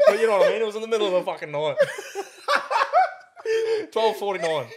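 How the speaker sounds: loud, high, through real laughter; laughing hard. Real, not acted. Defending a midnight screening at one A M, laughing hard. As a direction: crack up while protesting your own mistake.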